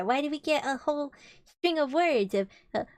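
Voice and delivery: ordinary delivery, nothing marked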